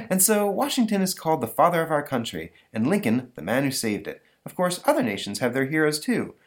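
a hint of a patient schoolteacher; teacher. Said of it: "teacher there ... saying".

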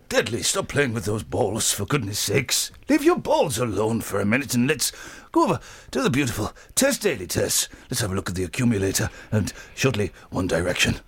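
low-pitched imitation of a veteran host; Terry Wogan. Host as Wogan scolds Deadly over balls, low mock-chiding impression. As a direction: chide in character, then roll into the links. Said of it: "you'll find that I'm Terry Wogan".

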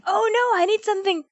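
high-pitched acted fretting; playful, exaggerated dismay; mock panic. Acts out her repeated panic.

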